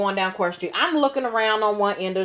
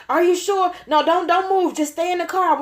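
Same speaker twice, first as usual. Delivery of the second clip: panicky man; 7 semitones higher than usual. Retold or imitated.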